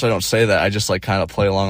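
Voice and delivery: ordinary talk, nothing marked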